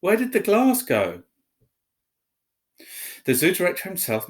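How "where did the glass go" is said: high, loud disbelief; shocked keeper. A narrator's reading. Zoo keeper in shock over the vanished glass, high and loud. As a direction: cry it out in shock. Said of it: "he kept saying".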